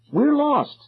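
high-pitched, pleading cry; desperate cry. A narrator's reading. Lost kid to silent strangers, desperate cry. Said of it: "I cried".

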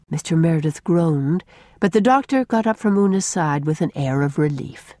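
usual speaking voice

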